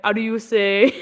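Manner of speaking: a touch of French on "How do you say?"; about the French flag emoji, light French accent; mock French accent. Lightly French, mock. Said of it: "I put a French flag".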